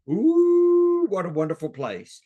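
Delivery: high swooping ooh then a gushing phrase; delighted ooh. Shown as a demonstration. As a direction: gush over their new town. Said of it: "let me give you a, an example here".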